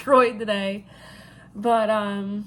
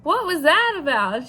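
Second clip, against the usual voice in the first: baffled questioning; 6 semitones higher, 4 dB louder than usual. Retold or imitated. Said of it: "So I went over to catering".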